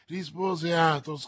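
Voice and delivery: usual speaking voice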